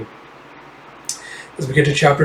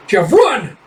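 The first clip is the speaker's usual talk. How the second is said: urgent shout; 7 semitones higher than usual. Acted.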